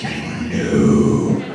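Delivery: very low, creaky slow voice; dropped deep and slow; low drawn-out reply. Retold or imitated. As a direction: drag it out way down low. Retold reply from shaken coworker.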